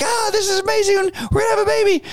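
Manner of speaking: high, thrilled squeal of joy; super excited. Retold or imitated. Expecting father hearing pregnancy news, squealing with excitement. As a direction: burst out with delight at the news. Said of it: "you're super excited".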